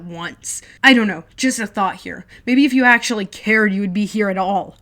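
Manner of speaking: loud sarcastic yell; furious kid yelling at brother; angry shout. Acted. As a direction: spit it back bitterly. Jack shouting back.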